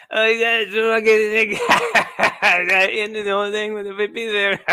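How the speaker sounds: high, laughing, slurred rock star ramble. Retold or imitated. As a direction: mumble it like nobody can follow you. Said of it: "he'd be just like".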